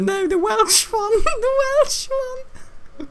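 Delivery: high squeaky laughing through the words; can barely get it out for laughing; real laughter, very high. Real, not acted.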